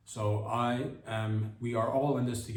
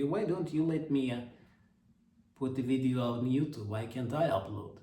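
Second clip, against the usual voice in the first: mock Putin. Comedian mocks Putin whining about YouTube. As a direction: whine like a petulant Putin. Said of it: "Putin might get out there and just be".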